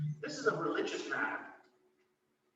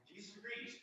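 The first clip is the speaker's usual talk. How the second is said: ordinary delivery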